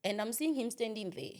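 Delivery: ordinary talk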